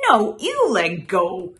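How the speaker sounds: loud storybook comeback; indignant retort. A narrator's reading. Rival answering Frog over the fly, loud and indignant. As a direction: throw it back loudly at the rival.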